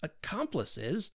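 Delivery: slow, drawn-out and offended; McVey bristling at the word, slow and offended; mild anger. Acted. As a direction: stretch the word out, a touch of anger. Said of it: "a shade of anger crossed his brow".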